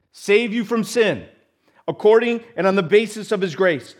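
loud, high, driving pulpit voice; full-force preaching. Acted. Preacher drives home to the congregation, loud and high. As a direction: build it up like a sermon climax.

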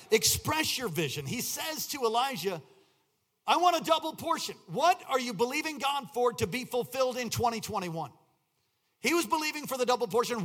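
loud, high, impassioned preacher; rally the congregation at full voice; full-force preaching. Real, not acted.

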